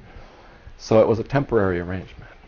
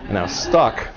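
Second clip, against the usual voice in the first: laughing. Real, not acted. Laughing at being stuck.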